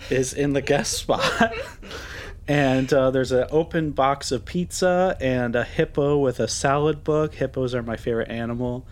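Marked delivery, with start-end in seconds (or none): none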